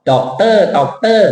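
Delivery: doctor doctor, each syllable high and even; Thai-style doctor. Shown as a demonstration. Teacher imitates how Thai students say it. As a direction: chop doctor into two equal beats. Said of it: "คนไทย doctor".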